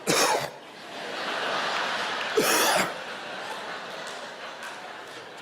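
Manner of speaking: long hacking gag of a dog; choking cough. Acted. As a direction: cough like something's stuck in the throat. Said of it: "walking around going".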